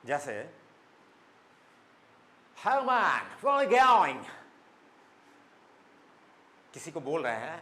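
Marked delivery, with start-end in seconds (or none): 2.6-4.2 loud, high, heavily stressed American English; American accent. Shown as a demonstration. Teacher demonstrating a street greeting in a punchy American accent. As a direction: hit it hard like an exaggerated American. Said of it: "American वाले थोड़ा ज़्यादा stress देते हैं".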